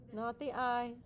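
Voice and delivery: ordinary talk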